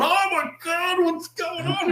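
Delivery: high-pitched mock alarm; panicked crowd. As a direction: imitate a shocked bystander crying out. Retold shock of the crowd extras.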